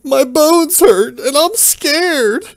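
very high, slow whimpering wail; crying. Acted. Maimed Randy cries to his friends, high and whimpering. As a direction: whine it like a hurt child. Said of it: "Ricket Randy begins to cry".

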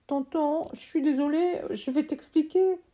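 put-on a kid pleading to be heard; boy's voice.

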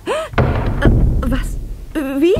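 very high, flustered stammer; startled and flustered. Acted. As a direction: caught off guard, stumbling. Tanja caught by Michael.

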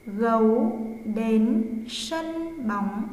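slow, word by word dictation; dictating. Shown as a demonstration. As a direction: read slowly for pupils to write. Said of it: "Các em chú ý khi cô đọc".

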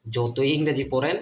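normal talking voice

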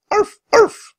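very high, loud yapping barks; sharp little imitated dog yaps; dog barking. A narrator's reading. Lost little dog calls from afar, barking. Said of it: "The barking came from a distance".

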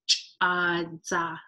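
Ch'adza drawn out slowly for practice; Ch'adza sounded out slowly. Shown as a demonstration. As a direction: say Ch'adza slowly so learners can copy. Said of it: "So if you wanna practice".

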